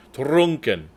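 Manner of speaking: ordinary delivery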